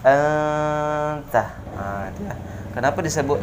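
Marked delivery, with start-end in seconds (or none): 0.1-1.4 anta with the n hummed two beats; correct nasal hold. Shown as a demonstration. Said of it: "didengung dua rakaat". (anta dengan dengung dua harokat)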